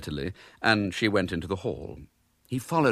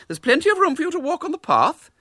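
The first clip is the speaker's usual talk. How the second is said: high, prim lady's voice; a polite spinster forcing kindness; 6 dB louder than usual. A narrator's reading. Miss Milton to the disguised boy, high and prim.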